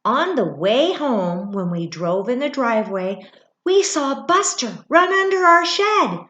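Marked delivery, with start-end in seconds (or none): 3.6-6.2 higher, louder sudden excitement; thrilled storybook reveal; excited. A narrator's reading. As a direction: burst out with the discovery. Cat found.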